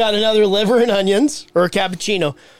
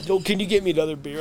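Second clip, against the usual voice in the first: usual speaking voice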